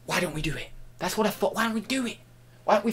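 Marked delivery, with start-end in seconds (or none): none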